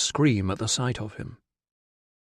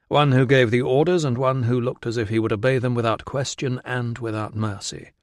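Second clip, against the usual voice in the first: ordinary delivery, nothing marked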